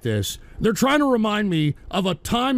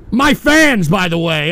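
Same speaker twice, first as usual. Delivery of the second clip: sarcastic loud; 8 dB louder than usual. Real, not acted. Streamer sarcastically showing off trolling fans, loud. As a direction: present them mockingly.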